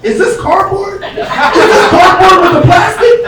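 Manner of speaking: high, slow, outraged questioning; hammed-up trash cop grilling you about cardboard. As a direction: squeal it up high in mock outrage. Angry trash cop inspecting.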